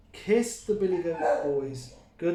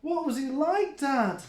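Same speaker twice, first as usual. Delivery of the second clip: put-on high curious child voice; a small kid asking, wide-eyed; little kid goat; 10 semitones higher, 3 dB louder than usual. A narrator's reading. Billy the Kid asks dad, high and curious. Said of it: "asked Billy the Kid".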